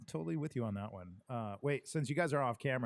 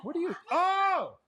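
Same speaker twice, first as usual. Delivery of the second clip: high shocked squeal with a laugh; disgusted shriek; 6 dB louder, slower than usual. Real, not acted. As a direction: recoil from the picture with a squeal. Sees gross picture, shrieks.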